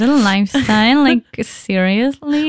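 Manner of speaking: slow, drawled posh English; mock jet-setter. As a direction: play the snobby jet-setter for laughs. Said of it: "wir klingen wie die ärgsten Jet-Setter".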